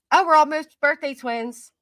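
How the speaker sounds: sing-song mock of the commenter; mocking quote. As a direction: parrot the comment back. Reads a commenter's remark mockingly.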